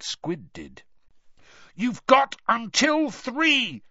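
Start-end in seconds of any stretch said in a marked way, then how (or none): 1.7-3.8 high, drawn-out shout; shouted warning. A narrator's reading. Harry threatens the mermen with a countdown, shouting. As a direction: call it out slowly, pitched far above the narration. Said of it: "Harry shouted".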